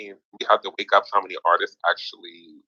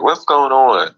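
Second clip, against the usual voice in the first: a louder, higher what's going on; loud mock confusion; 7 semitones higher, 6 dB louder, slower than usual. To a friend lost in the rooms, a mock confused call. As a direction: play the lost friend calling out. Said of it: "you're like".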